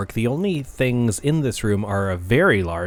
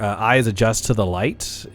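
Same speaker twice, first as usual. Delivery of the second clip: normal talking voice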